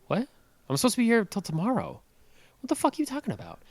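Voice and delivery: retold disbelief. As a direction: rattle it off in shocked disbelief. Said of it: "And I was like".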